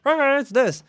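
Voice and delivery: high, loud, failed Irish accent; would-be Irish accent. Shown as a demonstration. Showing he has no accents, mock Irish accent. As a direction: badly fake Irish with one loud stock voice. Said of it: "you ask me to do Irish".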